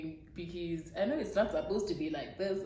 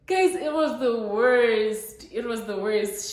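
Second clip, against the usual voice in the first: louder, slow exasperated groan of a line; 5 semitones higher, 10 dB louder, slower than usual. Real, not acted. Telling her viewers how bad it was, loud and exasperated. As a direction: lean in and despair to the crowd.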